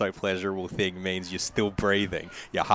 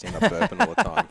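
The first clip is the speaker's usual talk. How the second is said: laughing; 12 semitones higher, 5 dB louder, faster than usual. Real, not acted. Laughs at burping while eating.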